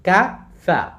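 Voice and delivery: কাফা as two short beats; no stretch on either vowel of কাফা; quick syllables. Shown as a demonstration. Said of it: "কাফা তাড়াতাড়ি".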